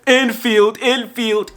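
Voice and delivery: high mocking kid chant; kids taunting. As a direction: taunt it like a schoolyard brat. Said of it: "these little kids screamed out".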